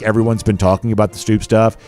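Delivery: ordinary talk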